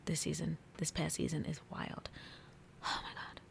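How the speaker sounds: hushed, almost voiceless amazement; awed whisper. Real, not acted. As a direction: whisper it, overwhelmed by the drama. Overwhelmed by the show's wild season.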